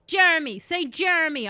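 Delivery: playfully bully him into saying the name; mock aggressive command. Mock slaver ordering a captive to say his name, loud aggressive command. Acts master forcing captive to speak.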